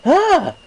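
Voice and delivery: loud, high playful shriek; mock startled yelp. A narrator's reading. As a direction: echo her fright with a teasing cry. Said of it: "mirroring her jump".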